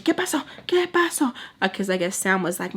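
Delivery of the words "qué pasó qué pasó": high-pitched frantic questioning; all kinds of crazy. Retold or imitated. Baltimore coming to the door, imitated high frantic questions. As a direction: imitate someone bursting in demanding answers. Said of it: "all kinds of crazy".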